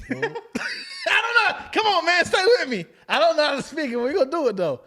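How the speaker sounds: high, loud pleading through real laughter; laughing plea. Real, not acted. Host failing at Spanish to his guest, laughing plea. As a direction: beg for patience while cracking up.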